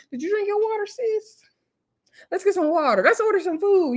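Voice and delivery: put-on high-pitched, checking on a drunk friend; coaxing friend. Friend looking after drunk sis, high-pitched coaxing.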